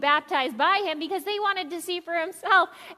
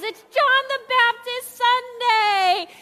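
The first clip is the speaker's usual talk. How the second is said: slow, loud, higher, coaxing the room; rally the congregation to join in; excited; 8 semitones higher, 5 dB louder, slower than usual. Real, not acted. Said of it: "Ah, come on, guys".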